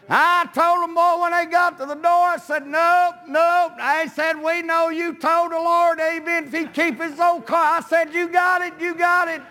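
high, ringing preacher's delivery; preaching. Real, not acted. Preacher telling how he stood firm at the door, preaching. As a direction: preach the story out at a high pitch.

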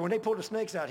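loud, high burst of remembered alarm; mock alarmed shout; retold exclamation. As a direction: blurt it like the memory still spooks him. Father's retold fright at snakes.

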